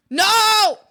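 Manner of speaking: sudden high, loud no; loud cry of no. Real, not acted. Streamer yelling at frog about to jump, loud. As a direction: shout it as if stopping a fall.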